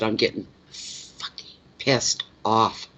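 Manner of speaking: whispered, slow and seething; angry whisper. Retold or imitated. Friend on the beach fuming about the trip, whispered. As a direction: hiss it under your breath, fuming.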